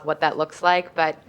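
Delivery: normal talking voice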